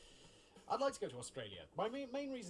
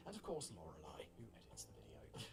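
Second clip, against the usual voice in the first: unvoiced whisper, much lower and softer; whispered aside; 9 semitones lower, 8 dB quieter than usual. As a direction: confide the credit in a whisper. Aside crediting the editor.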